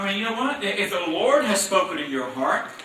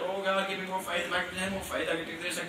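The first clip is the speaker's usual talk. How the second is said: softer mock pleading for faith; whining prayer; 2 semitones lower, 9 dB quieter than usual. Believer begging God for more faith, mild mock whine. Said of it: "there's no reason to be out there".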